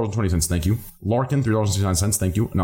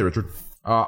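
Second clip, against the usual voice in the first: ordinary talk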